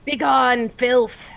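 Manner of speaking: loud, scornful command to a poisoner; commanding banish. Acted. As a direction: drive the foul thing away with your voice. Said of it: "foul odor and says".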